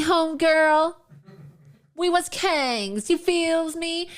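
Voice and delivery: slow, drawn-out hammed-up slang voice; exaggerated mock slang, lines stretched slowly; mocking parody of Kamala Harris. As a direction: lampoon her put-on accent, over the top.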